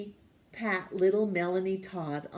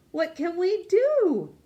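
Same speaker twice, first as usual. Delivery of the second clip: put-on high, loud worried children; children's voices; 11 semitones higher, 5 dB louder than usual. A narrator's reading. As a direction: ask it high and loud like eager kids. Children asking what to do.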